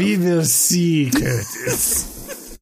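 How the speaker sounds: slow, hammed-up bad Serbian villain accent. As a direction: drag it out like a cartoon Balkan villain. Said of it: "the worst accent work I've heard".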